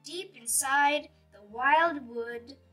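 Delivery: ordinary delivery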